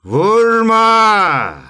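father stopping his son's punch with a loud shout. Acted. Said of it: "diye bağırınca".

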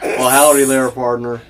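cowboy twang, Western howdy; Western accent. Acted. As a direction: greet them in a put-on Western accent. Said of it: "That's Western".

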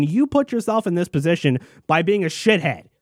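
ordinary talk

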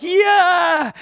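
high, loud drawn-out cheer; jubilant cry. Acted. Younger people cheering the new temple foundations, jubilant cry. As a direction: whoop it out like a delighted crowd. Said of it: "a big cry went up".

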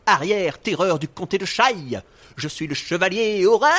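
loud, high heroic cry; heroic shout. Acted. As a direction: proclaim it like a brave knight. Hero's heroic entrance cry.

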